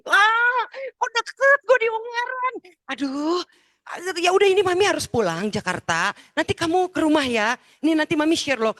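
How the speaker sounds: loud, very high squeal; acted high-pitched excitement; thrilled mother. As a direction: shriek with excitement, then rush out instructions. Thrilled mother learns son is near.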